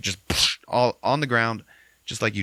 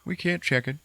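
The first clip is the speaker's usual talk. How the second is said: higher, louder put-on disappointment; mock dismay; 10 semitones higher, 4 dB louder than usual. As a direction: whine that it's dead. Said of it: "Goes up to the trail cam".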